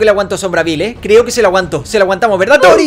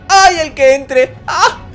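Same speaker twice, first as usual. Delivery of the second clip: excited taunt; 9 semitones higher, much slower than usual. Real, not acted. Taunting the switch-in.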